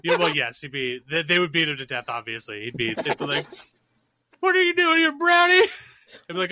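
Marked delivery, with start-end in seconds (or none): 4.4-5.7 very high, loud heckling yell; hostile mock yell. Acting out Klan confronting Jesus.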